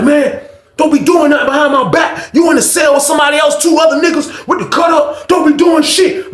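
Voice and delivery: angry yelling. Retold or imitated. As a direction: bark it like a furious inmate. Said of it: "All you hear is Chuck like".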